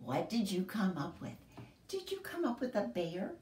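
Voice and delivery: ordinary delivery, nothing marked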